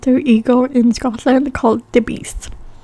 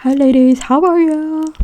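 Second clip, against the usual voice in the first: higher, sing-out imitation of a welcome; friendly greeting; 4 semitones higher, 4 dB louder than usual. Scottish shop staff greeting women, imitated friendliness. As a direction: call it out high and drawn to the customers.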